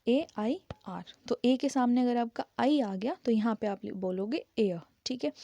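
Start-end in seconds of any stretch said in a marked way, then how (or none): 0.1-1.1 ए आई आर, each letter slow and separate; slow spelling. Shown as a demonstration. Teacher spelling air slowly for students. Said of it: "कैसे बोल लिखेंगे?".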